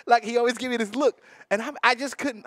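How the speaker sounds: ordinary talk, nothing marked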